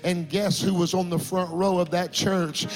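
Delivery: retelling the milk crate conversion with rising passion; rising preaching. Acted. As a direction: preach it with growing fervour. Preacher builds the sermon story.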